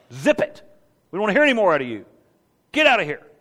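loud, high-swooping stern rebuke; short, forceful orders to a pest; stern telling-off. Acted. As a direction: shut him down sternly. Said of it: "Sternly, it says".

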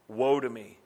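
ordinary delivery

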